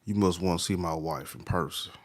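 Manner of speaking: normal talking voice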